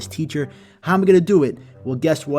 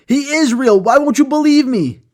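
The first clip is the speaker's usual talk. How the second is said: much higher and louder, frantic; hysterical protest; 11 semitones higher, 8 dB louder than usual. Acted. Deborah insisting the boy is real, hysterical shriek. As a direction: shriek the protest, near tears.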